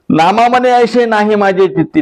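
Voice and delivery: high insistent refusal; Namdev refusing. Acted. Namdev refusing God in a high voice. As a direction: Namdev pushes back, pitch up.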